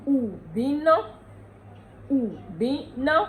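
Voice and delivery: Ubino said slowly, syllable by syllable; Ondo word Ubino. Shown as a demonstration. Teacher modelling Ondo word Ubino slowly. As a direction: draw out u-bi-no for the learner. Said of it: "Ondo is".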